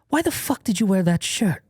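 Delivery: high-pitched imitation of an appalled friend; comically aghast retelling; mock incredulous. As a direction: demand it in high, exasperated disbelief. Retold friend's incredulous complaint about shirt.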